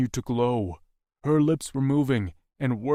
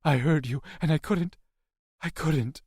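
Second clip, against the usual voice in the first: higher, pleading little voice; distressed, apologetic, pitched up; 5 semitones higher than usual. A narrator's reading. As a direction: plead in broken phrases. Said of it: "she pleaded".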